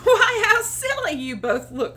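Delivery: high, loud teasing dog voice; high mocking voice of the dog; dog laughing at others. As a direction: tease them, amused. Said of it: "said the dog".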